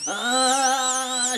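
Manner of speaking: plain reading voice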